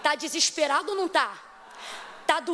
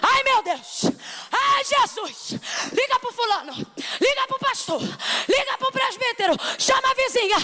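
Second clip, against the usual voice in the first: frantic panic; 8 semitones higher than usual. Pretend. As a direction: rattle off calls for help in a panic. Mother panicking, imagined scene. (pânico frenético, mais agudo)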